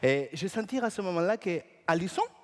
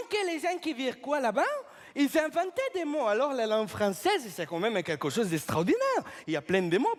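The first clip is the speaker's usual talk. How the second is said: ordinary delivery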